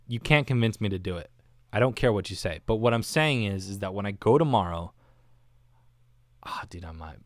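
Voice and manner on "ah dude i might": guilty whispered confession; hushed, sheepish whisper. Real, not acted. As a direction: admit it under your breath.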